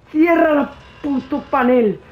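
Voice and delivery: yelling at the game to shut the panel; angry yell. Real, not acted. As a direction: bark it at the screen.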